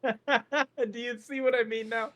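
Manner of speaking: laughing question. Real, not acted. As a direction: ask while cracking up. Laugh heard, high.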